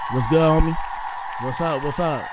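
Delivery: ordinary talk, nothing marked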